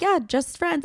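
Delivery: a touch of cringe in the voice; nervous awkward echo. Retold or imitated. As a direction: mimic her own nervous blurt. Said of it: "It was just a nervous fucking tick".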